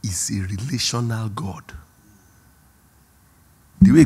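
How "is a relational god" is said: hushed whisper, slowed; a whispered "God is a relational God". Real, not acted. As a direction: lean in and whisper the truth. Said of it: "whispered point".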